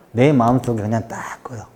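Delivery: low, soft, half-whispered confiding; hushed. Shown as a demonstration. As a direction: drop to a secretive hush, as if inside the heart. Silent inner cutting-off of someone.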